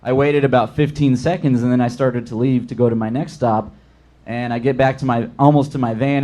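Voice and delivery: ordinary delivery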